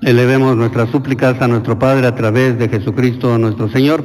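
steady liturgical prayer voice; intercession prayer. Acted. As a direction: lead the congregation in petitions. Leads prayers of the faithful.

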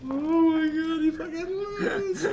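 high, slow, laughing till crying; crying with laughter. Real, not acted. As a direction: wheeze it out through tears of laughter. Cracking up at the crash.

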